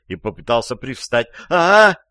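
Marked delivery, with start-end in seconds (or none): 1.5-2.0 loud, high female scream; женский крик. A narrator's reading. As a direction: cry out in alarm. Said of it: "заорала женщина". (громкий женский вопль)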